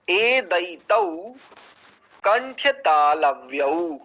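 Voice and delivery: ए दयितौ drawn out very slowly; sutra recitation. Shown as a demonstration. Teacher reciting a sutra for the class, slow. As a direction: chant the sutra slowly, syllable by syllable. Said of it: "इसका उच्चारण देखिए सूत्र का".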